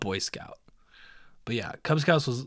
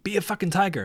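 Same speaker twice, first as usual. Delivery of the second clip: raised-pitch pep-talk command; retold coach's push; motivating order; 6 semitones higher, faster than usual. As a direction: urge the client on firmly. Coach quote, repeated louder after "What?.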